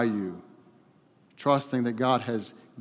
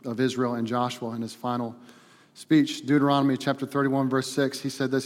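normal talking voice

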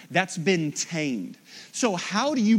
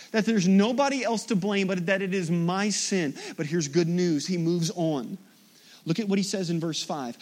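ordinary talk

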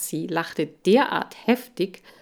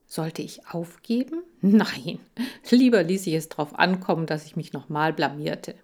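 ordinary delivery

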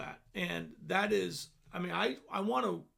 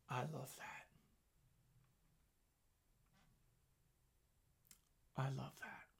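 much softer, murmured to himself; 4 semitones lower, 10 dB quieter than usual. Real, not acted. Preacher murmuring after reading Isaiah, much softer. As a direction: savour it under your breath.

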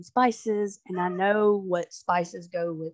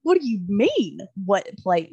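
incredulous retort, pitched up and louder; indignant echo; 5 dB louder than usual. Retold or imitated. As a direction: throw the question back in mock outrage. Indignant retort to husband, retold.

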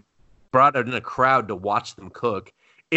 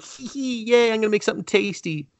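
cutesy giggly wife; 8 semitones higher than usual. Retold or imitated. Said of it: "And she's all like".

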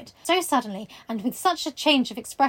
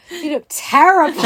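loud, higher, hammed-up verdict; retold teacher's remark; 4 semitones higher, 11 dB louder than usual. Teacher remarks on the student returning after flu, loud mock exclamation. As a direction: imitate a teacher exclaiming over it. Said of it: "one of my teachers was like".

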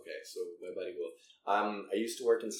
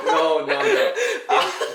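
laughing; 12 dB louder, much slower than usual. Real, not acted. Still laughing after a joke.

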